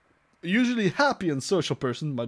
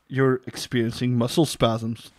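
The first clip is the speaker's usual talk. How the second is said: usual speaking voice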